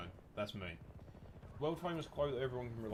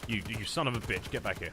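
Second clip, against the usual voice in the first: louder, furious at the enemy; angry yell; 5 dB louder than usual. Real, not acted. Gamer yelling at an enemy, angry and loud. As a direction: snap at him to come back.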